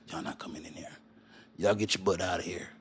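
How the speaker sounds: low, hushed hammed-up Texan; put-on country voice for China; Chinese Texan voice. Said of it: "that's my voice for everything".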